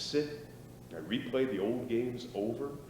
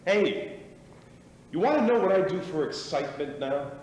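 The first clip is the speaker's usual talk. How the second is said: excited boast; 6 semitones higher, 8 dB louder than usual. Acted. Bursts in to boast.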